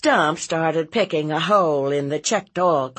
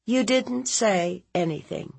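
higher, questioning echo; disbelieving aunt; 7 semitones higher than usual. Acted. Aunt challenging her nephew's denial, high and sceptical. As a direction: throw the denial back in disbelief.

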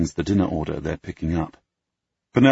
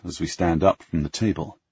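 ordinary delivery

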